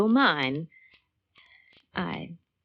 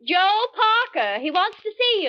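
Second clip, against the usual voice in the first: calling out; 12 semitones higher than usual. Acted. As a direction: project it across the lawn, pitched up. Calling across to Jerry.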